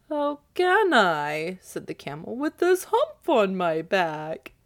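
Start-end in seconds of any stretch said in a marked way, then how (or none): 0.1-1.6 slow, high complaining camel; grumbling camel voice. Acted. 2.3-4.5 grumbling camel voice. Acted. Camel complains.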